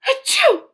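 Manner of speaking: loud, high achoo; acted sneeze. Gerald sneezing at Doctor Cat, loud. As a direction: burst out a sneeze.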